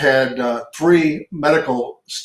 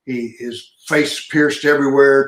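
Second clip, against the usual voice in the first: ordinary talk, nothing marked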